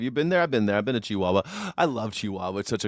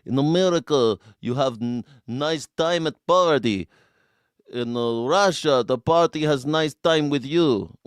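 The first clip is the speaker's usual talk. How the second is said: put-on thick Russian comic accent; Yakov Smirnoff. Streamer showing the comic's shtick, thick Russian accent. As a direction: deliver the reversal punchline in a Russian accent. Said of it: "he would always say things like that".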